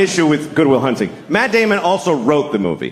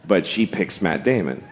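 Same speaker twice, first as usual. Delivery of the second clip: ordinary talk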